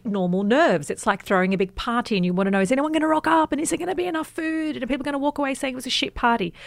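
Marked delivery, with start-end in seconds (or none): 2.6-6.5 quick, higher-pitched run of fretful questions; rattled-off worries. Retold or imitated. Author voices party-thrower's nerves, hurried mock worry. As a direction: reel off a host's party jitters in one rush. Voicing a nervous host's worries.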